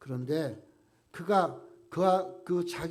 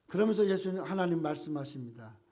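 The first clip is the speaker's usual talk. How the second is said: ordinary talk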